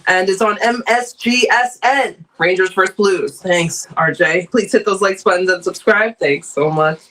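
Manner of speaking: ordinary talk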